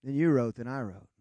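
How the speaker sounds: usual speaking voice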